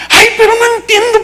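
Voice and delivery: very high-pitched whining wail; mock lament. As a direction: imitate the distraught relative, high and plaintive. Mimics the uncomprehending wailing relative. (lamento agudo, en tono de burla)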